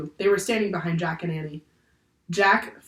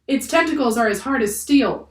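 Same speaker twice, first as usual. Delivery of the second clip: higher, louder sailor's call; shouted warning; 6 semitones higher, 5 dB louder than usual. A narrator's reading. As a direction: call it out over the crowd. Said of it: "shouted one of the sailors".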